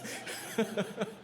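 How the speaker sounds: breathy embarrassed chuckle; soft laugh. Real, not acted. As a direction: laugh it off quietly. Embarrassed about doing accents.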